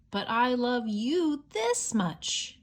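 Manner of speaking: slow, loving father hare; a hint of big hare. Big hare answering his son, slow and loving. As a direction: stretch it out gently, like a proud parent. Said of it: "he said".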